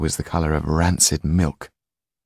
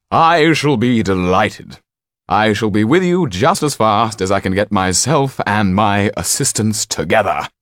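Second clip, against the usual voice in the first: higher, louder, eager showman; delighted magician; 4 semitones higher, 6 dB louder than usual. A narrator's reading. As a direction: accept with grand, effusive delight. Delighted magician accepts the offer.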